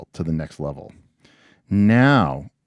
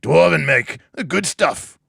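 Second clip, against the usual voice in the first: high, loud hammed-up Scottish barkeeper; bad Scottish accent; 9 semitones higher, 7 dB louder, slower than usual. Barkeeper inviting an adventurer for a drink, bad Scottish accent. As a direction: call out in a badly faked Scottish brogue. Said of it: "in a bad Scottish accent".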